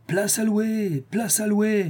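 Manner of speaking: high sing-song hawker's cry; crowd hawkers crying. Retold or imitated. The skeleton mimics the crowd at the guillotine, high hawking call. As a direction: call out seats for rent like a street vendor. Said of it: "Je les entends déjà crier".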